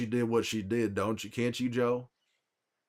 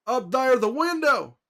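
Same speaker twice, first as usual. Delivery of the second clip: much higher, louder urgent callout; Tommy calling out; 12 semitones higher, 5 dB louder than usual. Retold or imitated. Imitating Tommy spotting an enemy, high urgent shout. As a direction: shout the warning up at the window like Tommy. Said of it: "do an impression of my brother".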